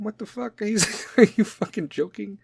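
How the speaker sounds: high-pitched disbelief breaking into laughter; laughing, mock-outraged disbelief; incredulous. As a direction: react as if it's absurd. Incredulous reaction to late invite.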